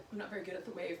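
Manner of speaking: normal talking voice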